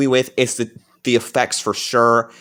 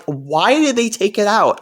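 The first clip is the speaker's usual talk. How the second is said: baffled; 9 semitones higher, 3 dB louder than usual. Retold or imitated. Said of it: "and I'm like".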